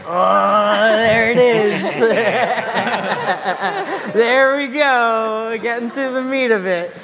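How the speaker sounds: high laughing delight. Real, not acted. Laughing, question finally gets going.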